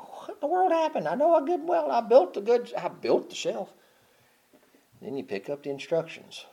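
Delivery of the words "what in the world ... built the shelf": high, bewildered cry; baffled mock exclamation. Mocking a handyman whose shelf fell through, high and bewildered. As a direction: play the confused handyman. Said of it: "and you go".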